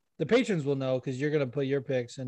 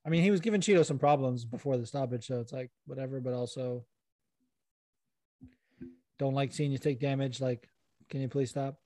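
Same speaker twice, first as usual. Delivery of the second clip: ordinary delivery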